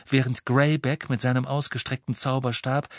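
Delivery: usual speaking voice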